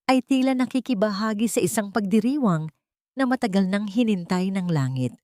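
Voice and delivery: usual speaking voice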